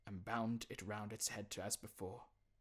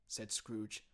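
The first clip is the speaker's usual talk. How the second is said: ordinary delivery, nothing marked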